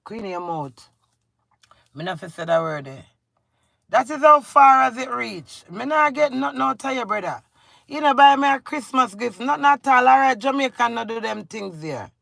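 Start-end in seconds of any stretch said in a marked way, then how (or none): 3.9-7.4 indignant outburst. Real, not acted. Angry rant at absent husband.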